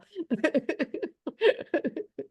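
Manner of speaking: a high amused laugh; light laugh. Real, not acted. Laughs after her own question.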